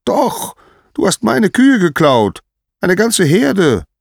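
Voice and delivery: very high, loud accusation; shrieked, indignant insistence; outraged shouting. Acted. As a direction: explode at a bare-faced denial. Apollo contradicts the denial.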